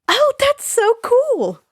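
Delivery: high, loud, slow gush; delighted. A narrator's reading. Annabeth realizing her speech corrects mid-sentence, delighted. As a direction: squeal with delight.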